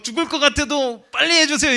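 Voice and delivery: high, loud mock plea to hurry; mock pleading yell. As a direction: ham up a desperate patient. Imitating a pleading patient. (높고 크게 애원하는 흉내)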